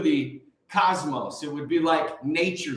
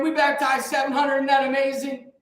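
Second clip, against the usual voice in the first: imitating an excited pastor, higher pitched; fired-up retelling; 7 semitones higher than usual. Anthony telling the dinner table about baptisms, fired up. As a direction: share the news like it can't be contained. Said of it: "Anthony was fired up, sharing".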